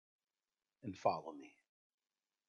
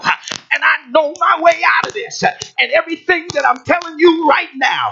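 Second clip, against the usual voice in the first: high, loud preacher calling out over music; preaching at full force; 12 semitones higher, 17 dB louder than usual. Acted. Preacher firing up the congregation, loud full-force preaching. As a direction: rouse the church, shouting the promise.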